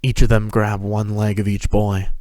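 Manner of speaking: ordinary delivery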